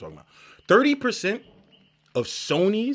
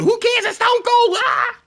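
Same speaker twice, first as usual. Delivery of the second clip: hyped fan; 4 dB louder, slower than usual. Retold or imitated. Fan voice shrugging off loss.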